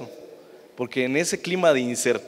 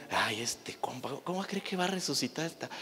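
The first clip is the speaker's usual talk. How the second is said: mocking scoffers; 9 dB quieter than usual.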